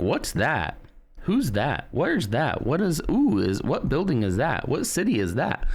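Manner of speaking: put-on high, excitable child asking questions; imitated curious kid. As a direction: pester with eager, swooping questions like a kid. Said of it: "and then be like".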